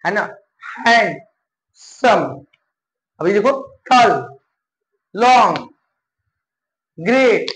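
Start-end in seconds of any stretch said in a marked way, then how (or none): 0.8-2.4 handsome very slow, hand, long gap, सम; broken into syllables. Shown as a demonstration. Teacher showing it cannot be one syllable, slowly split apart. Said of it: "जो एक syllable में नहीं बोल पाओगे". 3.9-7.5 टॉल, long, great as separate single beats; one unbroken beat for टॉल, long and great, pausing between. Shown as a demonstration. Teacher demonstrating one-syllable words, each in one go. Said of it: "एक बार में बोल दे रहे हो ना".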